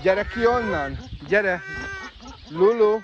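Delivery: high, loud calls; calling out. Real, not acted. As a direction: shout her out from under the bridge. Shouting bird out from bridge. (hangos, magas szólítás)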